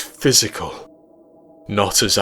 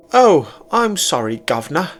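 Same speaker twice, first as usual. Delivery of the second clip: high, hammed-up British "governor" voice; bad mock British accent; 9 semitones higher, 5 dB louder than usual. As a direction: tease with a badly faked British accent. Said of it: "poorly imitating my British accent".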